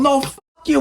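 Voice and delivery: very high, stretched comeback; mock angry retort. Musician firing back at the producer, very high. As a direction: throw it right back up high. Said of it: "I'm going".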